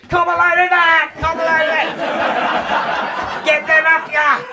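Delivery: high, loud laughing yell; hollering mate, cracking up; a mooning mate whooping high and loud. Retold or imitated. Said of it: "my mate just happens to be backing back towards the car going".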